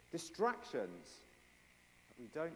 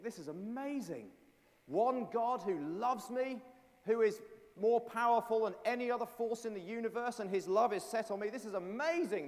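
amazed joy; 8 semitones higher, 6 dB louder than usual. Acted. Believer overjoyed at God's love.

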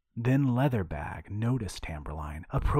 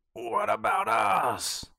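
slow, much higher whining moan; 14 semitones higher, 4 dB louder, slower than usual. A narrator's reading. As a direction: whine it like an old hag.

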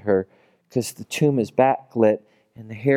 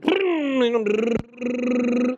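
airy amused chuckle; 11 semitones higher than usual. Real, not acted. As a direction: laugh at the joke. Laughing at the story.

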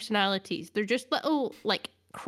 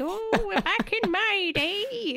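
put-on high, slow quavery old lady; mock granny reminiscing; 11 semitones higher, much slower than usual. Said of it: "sound a bit like an old lady".

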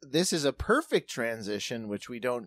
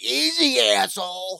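higher, louder mocking taunt; 7 semitones higher, 4 dB louder than usual. Mocking the rude cyclist, higher and louder. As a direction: sneer it like a rude stranger. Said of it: "he's like".

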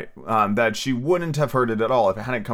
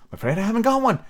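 frightened; 10 semitones higher, faster than usual. A narrator's reading. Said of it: "in a frightened tone".

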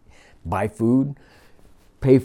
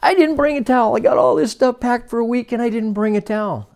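imitating a friend's exasperation, higher and louder; exasperated friend; 12 semitones higher, 4 dB louder than usual. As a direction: replay the friend's fuss over a forgotten towel. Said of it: "he was exasperated about something".

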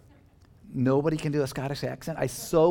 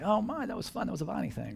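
higher, softer playful quote; mock churchgoers; 7 semitones higher, 4 dB quieter than usual. Congregation leaving after the service, playfully imitated in a higher voice. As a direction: lightly imitate a delighted churchgoer. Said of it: "you're all gonna go out today and say".

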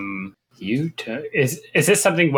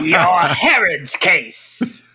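put-on slow, higher, slurring like a drunk; slurred, boozy delivery; slurred drunk answer; 5 semitones higher, much slower than usual. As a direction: drag the words out as if tipsy. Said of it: "as if you were drunk and trying to say".

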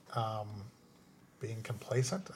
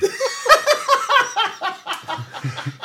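loud high laugh; 6 dB louder than usual. Real, not acted. Big laugh.

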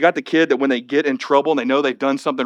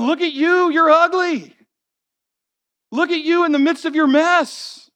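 higher, jeering taunt; mocking enemies. Enemies jeering at the weeping people, mocking. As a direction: sneer at them like a cruel bully. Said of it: "They start mocking 'em".

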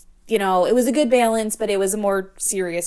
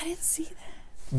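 whispering; 6 semitones higher, 11 dB quieter than usual. Real, not acted. Sudden whisper aside.

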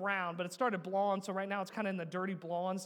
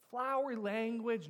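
ordinary delivery

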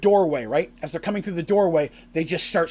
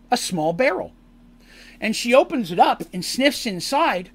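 ordinary talk

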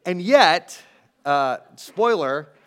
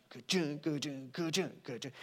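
imitated guitar chunk; 2 semitones lower, 13 dB quieter than usual. Shown as a demonstration. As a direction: quietly beat out the strum with your mouth. Imitating the strumming rhythm.

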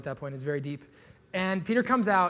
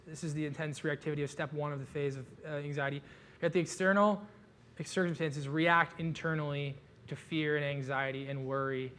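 ordinary talk

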